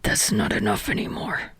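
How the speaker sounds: strained near-whisper; quiet, forced confession. A narrator's reading. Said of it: "forcing out the words".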